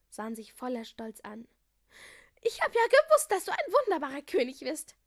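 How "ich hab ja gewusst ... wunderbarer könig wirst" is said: very high, loud gushing voice; delighted cry. A narrator's reading. Melissa praising new King Harry, high excited cry. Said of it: "rief Melissa".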